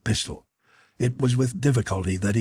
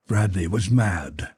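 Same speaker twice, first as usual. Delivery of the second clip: ordinary delivery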